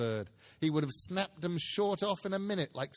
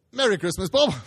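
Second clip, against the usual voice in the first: a loud, heartfelt cheer; mean every word of it; earnest greeting; 4 semitones higher, 11 dB louder than usual. Acted. Scrooge wishes Bob merry Christmas, loud and heartfelt. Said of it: "with an earnestness that could not be mistaken".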